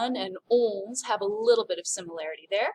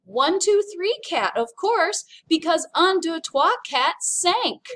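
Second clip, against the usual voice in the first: punchline said higher and louder, slowing at the end; joke punchline; 7 semitones higher, 6 dB louder than usual. Acted. Teacher telling students the cat pun, hammed-up punchline. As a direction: deliver the cat joke's big punchline. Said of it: "These are the jokes".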